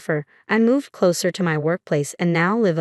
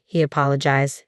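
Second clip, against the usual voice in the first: ordinary delivery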